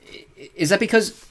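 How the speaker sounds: put-on boy swallowing hard before asking; gulping. A narrator's reading. Boy asking his friend's dad, nervous gulp. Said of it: "he gulped".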